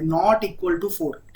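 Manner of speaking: very slow, word by word: not equal to four; slow read-out of code. Shown as a demonstration. Teacher showing students how to read the code, slow and deliberate. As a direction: spell the expression out slowly for students. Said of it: "हमेशा ऐसे पढ़ना है आपको".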